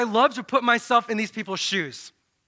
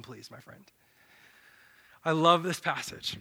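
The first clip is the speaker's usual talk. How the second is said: usual speaking voice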